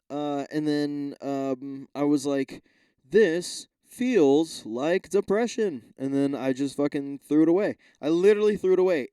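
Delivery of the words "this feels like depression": very slow mock-gloomy line; slow mock depression; deadpan despair. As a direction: drag it out like a sad confession. Retold inner thought, dramatic.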